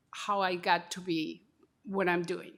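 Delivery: ordinary talk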